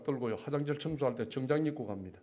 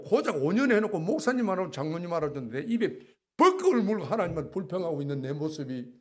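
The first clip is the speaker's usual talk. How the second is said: normal talking voice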